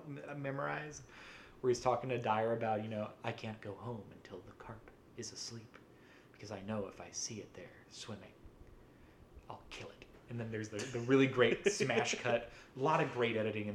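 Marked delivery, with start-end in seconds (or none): 3.2-10.0 hushed, much softer menacing quote, fading into a whisper; quoting a monologue. Retold or imitated. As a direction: drop to a near whisper for the villain's confession. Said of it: "I have his carp monologue".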